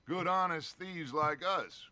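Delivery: ordinary talk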